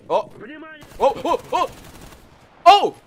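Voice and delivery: sharp startled yelps, one after another; shocked yelps. Real, not acted. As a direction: yelp out in real shock, again and again. Sudden ambush in game.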